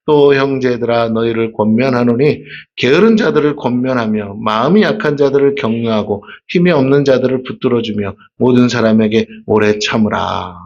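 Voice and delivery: measured lectern recitation; scripture reading. Acted. Reads scripture verse.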